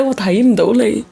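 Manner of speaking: usual speaking voice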